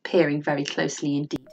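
plain reading voice

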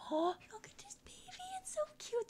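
cooing whisper. Real, not acted. Whispering over a puppy.

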